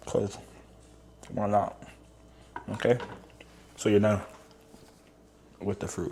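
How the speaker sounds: talking while chewing; words through a mouthful of banana; mouth full. Real, not acted. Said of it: "excuse me, I'm eating".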